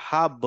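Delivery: "হাব" with a bounced final ব; echo. Shown as a demonstration. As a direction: let "হাব" echo into a short o. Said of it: "একটা ইকো হবে".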